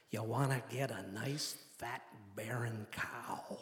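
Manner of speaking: low, hushed Midwestern drawl, very slow on the last words; imitated Midwestern accent. Pilot advises hunter in plane, slow Midwestern accent. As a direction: stretch out "fat, barren cow" like a Midwestern pilot. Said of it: "exaggerated Midwestern accent".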